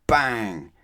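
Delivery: a punchy Bang!; loud bang. A narrator's reading. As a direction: slam the word like a door. Doors slammed loudly.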